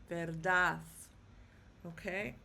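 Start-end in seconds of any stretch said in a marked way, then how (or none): 0.1-0.8 verdad said ver-DAD, firmly; stress on last syllable. Shown as a demonstration. Teacher repeating the pronounced word, verdad stressed on DAD. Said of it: "so it's pronounced".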